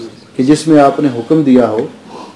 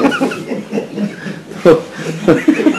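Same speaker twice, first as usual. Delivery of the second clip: high breathless giggle; amused giggle at the punchline; 11 semitones higher, 4 dB quieter than usual. Real, not acted. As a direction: let a high, airy giggle escape. Speaker laughs at own story.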